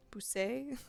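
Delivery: "Bousset" tried as poo-SAY, rising and loud; "Bousset" reattempted with a French ending, questioning; French attempt. Real, not acted. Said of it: "this French is killing me".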